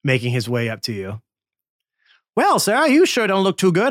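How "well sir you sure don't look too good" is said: much higher, louder huckster voice; slick salesman. Acted. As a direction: call out like a carnival pitchman. Scam Likely speaking.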